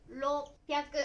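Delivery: 六百 read "rop-pyaku", stopped before the p; roppyaku. Shown as a demonstration. Teacher counting hundreds for learners, roppyaku with a held stop. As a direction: cut 六 to "rop", then "pyaku". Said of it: "発音は六百ですね".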